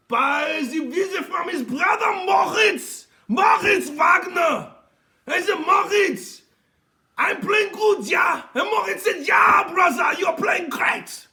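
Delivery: loud mock German brother talk; over-the-top German accent shout. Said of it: "That's your German accent?".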